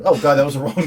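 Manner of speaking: normal talking voice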